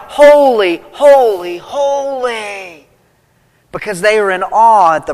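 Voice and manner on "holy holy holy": crying out. Retold or imitated. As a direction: cry holy in awe, first one loudest. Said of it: "the only thing they can do is cry out".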